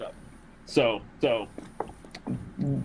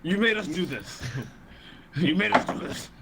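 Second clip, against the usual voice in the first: scolding; 7 semitones higher than usual. Pretend. General scolding victims.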